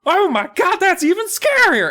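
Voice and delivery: put-on very high, loud shrieking girl; screamed in high falsetto; mock scream. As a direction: shriek it in hammed-up terror. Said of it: "she starts screaming even louder".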